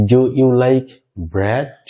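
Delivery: bread said with an open short e; model sentence. Shown as a demonstration. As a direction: clear model read of bread. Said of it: "আমার সাথে সাথে বলবা". (শিক্ষকের মতো স্পষ্ট উচ্চারণ)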